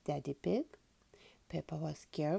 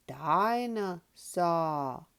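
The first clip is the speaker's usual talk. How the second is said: slow, drawn-out dinosaur growl; little boy pretending to be a dinosaur; 6 dB louder, much slower than usual. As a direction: stretch it into a toddler's dinosaur roar. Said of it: "he growled".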